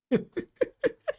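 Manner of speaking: squeaky high laugh at her own joke; high burst of laughter. Real, not acted. Telling a friend a silly story, high giggle.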